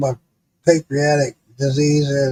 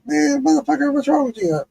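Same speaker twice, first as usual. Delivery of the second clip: lightly voiced coworker, higher pitch; a higher-pitched puzzled question; baffled coworker; 9 semitones higher than usual. Coworker's reaction voiced in retelling.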